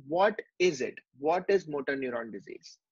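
ordinary talk

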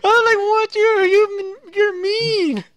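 high, loud mock outrage; outraged squeal. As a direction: squeal it like you're appalled. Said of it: "I'm like".

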